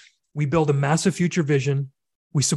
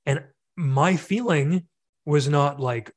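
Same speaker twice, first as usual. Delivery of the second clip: usual speaking voice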